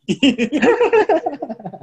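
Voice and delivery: a real laugh after the joke; amused laughter trailing off. Real, not acted. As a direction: laugh at your own comeback. Laughs after joking retort.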